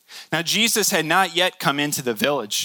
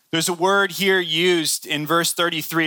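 ordinary delivery

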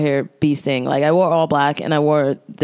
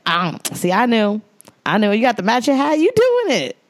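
imitating the lady, higher, ending on a high squeal; knowing New York lady hyping her up, higher; 5 semitones higher than usual. Lady praising the matching hat, in a higher New York accent. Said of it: "that was her accent".

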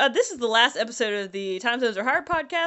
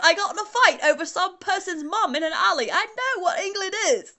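indignant fake English accent, pitched up at the end; mock English accent; 5 semitones higher, 3 dB louder than usual. Insisting on knowing England, mock English accent. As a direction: protest in a hammed-up English accent. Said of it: "what fucking people from England sound like".

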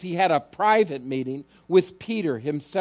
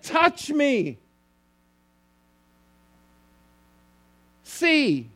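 urging Jesus; 9 semitones higher, much slower than usual. Acted. Preacher voices Jesus again.

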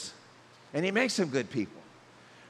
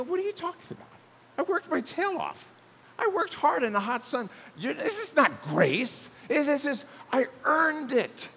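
higher, mock-outraged protest; indignant worker; 6 semitones higher than usual. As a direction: squeak out your protest in mock disbelief. Worker protesting his wages.

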